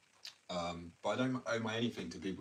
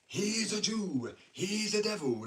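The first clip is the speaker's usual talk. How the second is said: high, loud, drawn-out mocking wail; mock outcry; 12 semitones higher, 6 dB louder, much slower than usual. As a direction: imitate hysterical accusers, stretching each word high. Mimics accusers' hysterical outcry.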